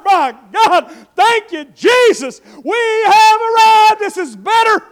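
slow, loud, high shout of relief; relieved disciples. Acted. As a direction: cry out thanks on reaching land.

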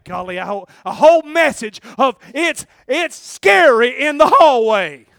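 loud, high preaching that keeps climbing; preaching at full force. Acted.

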